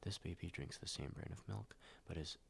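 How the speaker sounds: normal talking voice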